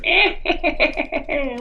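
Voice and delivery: high, drawn-out maniacal cackle; put-on crazed "eh-heh-heh" cackle; Joker cackle. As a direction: imitate a villain's staccato laugh. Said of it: "all he did was".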